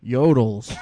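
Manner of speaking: dropped pitch. Retold or imitated. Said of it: "Complete and total change of the pitch in her voice".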